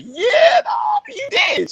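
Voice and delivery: squealing high excited yell, stretched slow; excited cheer. Retold or imitated. Proud dad cheering daughter's first full swim, squealing high. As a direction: scream it out like a proud hype dad. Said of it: "I was excited".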